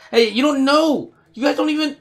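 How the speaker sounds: a creature yelling high at the panicking humans; high calling out. Pretend. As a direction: call after them in a high, pleading yell.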